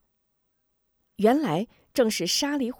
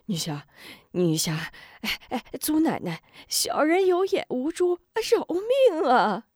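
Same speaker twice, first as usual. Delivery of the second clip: high, frightened pleading, rising to a wail; a coward on his knees begging for his life; begging for mercy; 8 semitones higher than usual. Acted. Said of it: "跪地求饶道".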